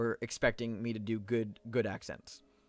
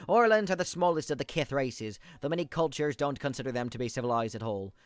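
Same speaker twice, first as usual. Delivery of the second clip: put-on high Irish accent. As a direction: read it like a small, perky Irish fellow. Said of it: "kind of a nasally, high-pitched Irish one".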